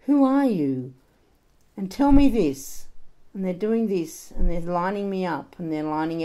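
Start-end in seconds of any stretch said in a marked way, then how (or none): 0.1-2.8 raised, louder interrogating teacher voice; demanding teacher. Acted. Teachers questioning pupils at school, loud and demanding. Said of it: "and the teachers are saying".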